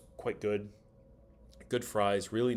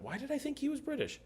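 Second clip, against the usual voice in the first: pitched way up in disbelief; puzzled high question. Real, not acted. Streamer baffled at own mix-up, rising high in disbelief. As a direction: ask yourself, genuinely baffled.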